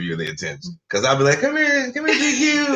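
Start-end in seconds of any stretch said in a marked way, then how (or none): 1.4-2.8 calling the cat sweetly, pitched way up; high coaxing call. Retold or imitated. Owner calling the cat over, high coaxing voice.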